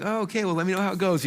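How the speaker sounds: lightly mimicked smiling wife; indulgent wife. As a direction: humor him with a light, noncommittal okay. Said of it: "smiles, like".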